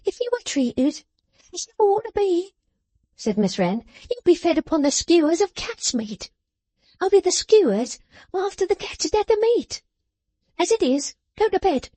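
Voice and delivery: high, sharp scolding voice; scolding little woman. A narrator's reading. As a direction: scold him like a child. Jenny scolding.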